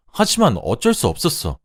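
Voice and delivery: high-pitched, acted anguished plea; wailing plea. A narrator's reading. Husband ending his confession to the wife, wailing. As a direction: cry out in helpless protest. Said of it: "라며 울부짖었습니다".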